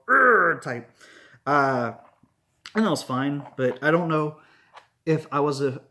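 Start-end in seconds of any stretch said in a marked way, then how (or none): 0.1-0.6 loud, high mimicked "urgh"; imitated roar. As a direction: burst out with a mock roar. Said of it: "I'm used to the more".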